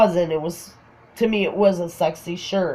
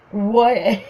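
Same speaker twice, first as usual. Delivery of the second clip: higher, breaking into laughter; imitated laughing question; 4 semitones higher than usual. Friend unable to stop laughing at her, laughing question. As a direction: act out asking through laughter. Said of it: "He's laughing".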